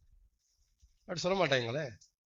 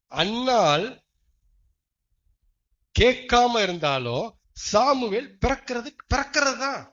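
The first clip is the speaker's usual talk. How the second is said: slow, loud declaration; loud preaching; 6 semitones higher, 8 dB louder, slower than usual. Acted. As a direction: proclaim it to the whole church. Preaching proclamation. (உரத்த பிரசங்க அறிவிப்பு)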